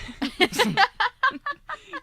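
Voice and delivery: high laugh, half choking. Real, not acted. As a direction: laugh while catching breath.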